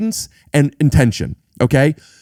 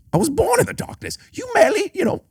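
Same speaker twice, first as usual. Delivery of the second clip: pitched way up, theatrical villain boom; Bane impression; faster than usual. Friend attempts a dramatic Bane line. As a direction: declaim it like a masked villain. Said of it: "didn't like the cadence".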